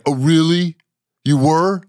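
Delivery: mock the manager's challenging comeback; manager impression. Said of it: "impression of me with a low voice".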